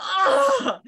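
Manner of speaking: high, loud exasperated "ah"; loud, high wail of exasperation; frustrated cry. Real, not acted. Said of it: "it's so frustrating".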